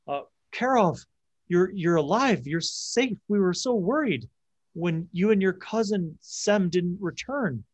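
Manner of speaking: a worried mother finding her son alive; older woman. Acted. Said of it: "the door is opened by an older woman".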